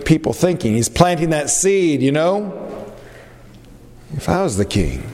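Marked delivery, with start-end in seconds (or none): 4.1-5.0 scheming promise. Acted. Absalom promising petitioners.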